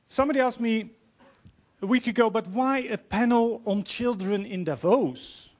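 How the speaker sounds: normal talking voice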